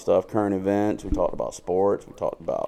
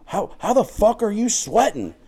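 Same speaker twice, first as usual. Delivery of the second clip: mock incredulous yell; 13 semitones higher than usual. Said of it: "he's like".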